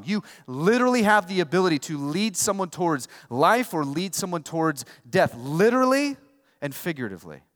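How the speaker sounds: loud, driving sermon delivery; fiery preaching. Acted. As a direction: preach it to the room with force. Preacher driving the point home.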